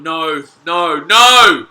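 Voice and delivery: dismayed protest. Real, not acted. Fan watching play go wrong, dismayed protest. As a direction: escalate from worry into a yell.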